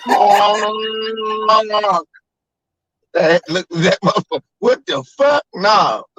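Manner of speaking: high, put-on shouting dad; mock shocked dad. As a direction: yell like a man seeing the devil. Voicing dad reacting to honk.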